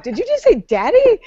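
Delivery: high-pitched incredulous question; mock disbelief. Reacting to grown men saying daddy, mock disbelief. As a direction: squeal it in appalled disbelief.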